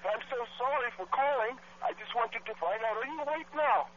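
high, soft put-on voice for a prank call; prank caller voice.

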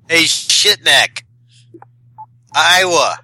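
usual speaking voice

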